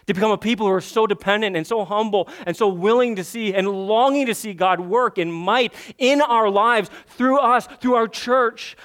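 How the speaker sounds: impassioned preaching, swelling; building sermon climax. Acted. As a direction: rise in fervour toward the end.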